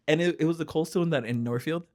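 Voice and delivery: normal talking voice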